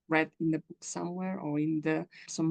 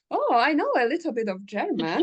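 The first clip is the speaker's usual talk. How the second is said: eager reply; 6 semitones higher, 5 dB louder than usual. Retold or imitated. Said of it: "And I'm like".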